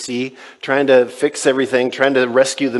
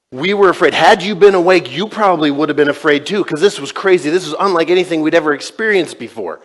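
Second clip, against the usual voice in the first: louder, higher protest; heated defence; 5 semitones higher, 6 dB louder than usual. Retold or imitated. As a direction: insist you had every right to fear. Disciples defending their fear.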